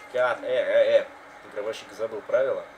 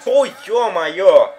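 long drawn-out loud groan of disbelief; stunned exclamation; 9 semitones higher, 13 dB louder, much slower than usual. Real, not acted. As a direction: drag it out in disbelief. Stunned reaction. (изумлённый возглас)

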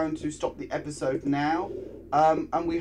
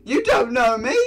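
mock-offended shout; 10 semitones higher, 8 dB louder than usual.

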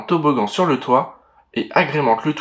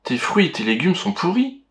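disgusted; 6 semitones higher than usual. Acted. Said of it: "écœuré".

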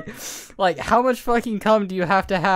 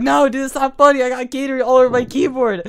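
louder, higher mock protest; upset friend, imitated; 5 semitones higher, 4 dB louder than usual. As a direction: mimic a friend pleading it's no joke. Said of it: "And Harry's like".

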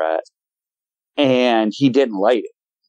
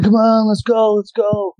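impatient cousins urging; eager calling; 10 semitones higher than usual. Retold or imitated. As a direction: chivvy her out of the cabin.

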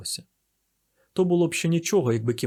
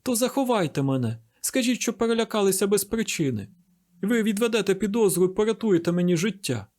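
high, insistent begging; acted fugitive's plea, pitched up throughout; pleading voice; 7 semitones higher than usual. A narrator's reading. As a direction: implore them to hide you. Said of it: "слухали той благальний голос".

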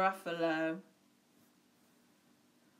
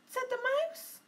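ordinary delivery

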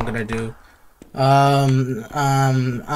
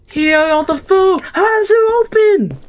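much higher, louder, near-screaming disbelief; panicked shriek; 12 semitones higher, 4 dB louder than usual. Real, not acted. Player finding the food gone, shrieking in alarm.

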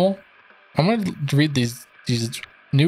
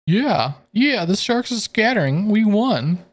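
lifted higher, triumphant hero voice; game hero cheering the win; higher character voice; 7 semitones higher than usual. Acted. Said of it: "keep going in and out of these voices".

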